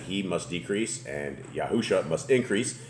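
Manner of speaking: normal talking voice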